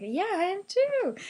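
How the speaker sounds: high, bright eager reply; excited. Retold or imitated. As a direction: gush it out happily. Eager reply about being musician.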